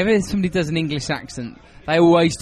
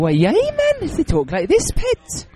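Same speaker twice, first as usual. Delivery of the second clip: high-pitched mock Geordie; Geordie accent; 14 semitones higher, slower than usual. Shown as a demonstration. Showing Americans a TV singer's speech, Geordie accent. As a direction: put on a squeaky, exaggerated Geordie. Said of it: "that's a Geordie accent".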